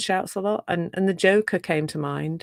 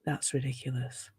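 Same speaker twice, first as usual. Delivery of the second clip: quiet, low mock exasperation; fed-up dismissal; 4 semitones lower, 6 dB quieter than usual. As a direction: sigh it off, softer and lower. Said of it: "push the Joker out and go".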